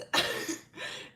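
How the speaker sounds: airy unvoiced giggle; soft wheeze of laughter; breathy laugh. Real, not acted. Vlogger wheezing with laughter.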